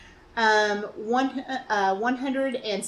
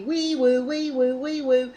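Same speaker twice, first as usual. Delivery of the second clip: sung wee woo siren noise, a little higher; imitated siren; 3 semitones higher, slower than usual. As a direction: mimic a police siren playfully. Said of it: "I hear the lights behind me".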